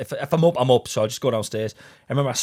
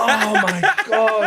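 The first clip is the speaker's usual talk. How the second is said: laughing; 7 semitones higher, 5 dB louder, much slower than usual. Real, not acted. Stunned by punchline.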